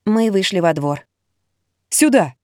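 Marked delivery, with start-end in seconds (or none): 1.9-2.3 commanding. Acted. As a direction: call out an order. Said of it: "скомандовала Ира". (командным тоном)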